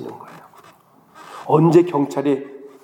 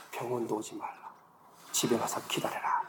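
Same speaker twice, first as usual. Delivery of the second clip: whisper; 2 semitones lower, 13 dB quieter than usual. Retold or imitated. Police ordering him home.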